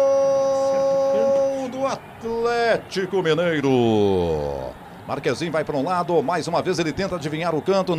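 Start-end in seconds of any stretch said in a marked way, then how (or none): 0.0-4.7 slow stretched goal call; drawn-out goal call. Real, not acted. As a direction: hold every vowel long.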